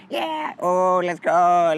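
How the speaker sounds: lightly voiced keen buddy; imitating Kevin. Kevin agreeing to go out again, mild mock bro voice. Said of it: "Kevin's like".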